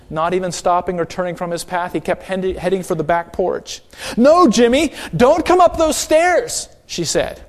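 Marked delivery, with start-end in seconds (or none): 4.2-6.7 much higher, louder urgent call, slowing on the stairs; urgent warning. Retold or imitated. Aretta warns Jimmy off the wet stairs, urgent high call. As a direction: stop the child before he touches the paint.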